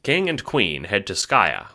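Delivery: ordinary delivery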